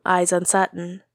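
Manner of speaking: usual speaking voice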